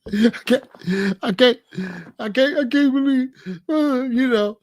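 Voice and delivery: hammed-up fake sobbing, broken repeats; fake crying. Practicing fake tears for his girlfriend, hammed-up sobbing. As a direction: stammer like you're choking on tears. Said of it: "I'll fake cry".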